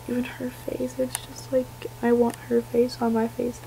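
soft, awed whisper; admiring whisper. Real, not acted. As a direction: breathe it out in awe. Admiring doll's face.

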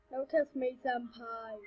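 ordinary delivery

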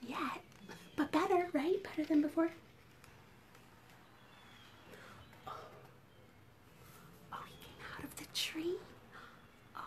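very soft, careful whispering; barely audible, cautious murmur; hushed whisper. Pretend. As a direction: whisper so the shy creature isn't startled. Keeping quiet for shy dinosaur.

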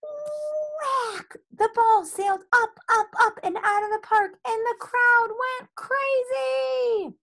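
high, soaring excited narration; excited climax. A narrator's reading. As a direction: build the thrill as the ball flies. Climactic home run narrated.

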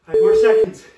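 loud countdown call; coach shouting. Real, not acted.

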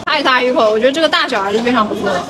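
chewing while talking; muffled through food; mouth full. Real, not acted. Seen 1: Man bit big tofu piece at -7, still chewing with closed lips.

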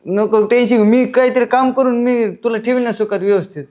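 husband laughing nervously to calm his angry wife; nervous laughing reassurance. Acted. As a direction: laugh awkwardly while promising to fix things.

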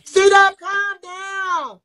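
loud, slow, high shout to calm him. Real, not acted. Yelling at drunk C-Dub to calm down, loud shout. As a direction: yell it out, dragging the words.

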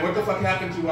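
ordinary talk

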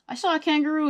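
ordinary delivery

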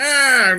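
high-pitched mock wail; hammed-up cry of horror; dramatic cry. American reporter at the airship disaster, high dramatic cry. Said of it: "the real drama of it".

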